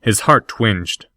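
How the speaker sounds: plain reading voice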